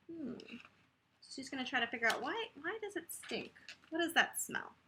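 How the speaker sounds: ordinary delivery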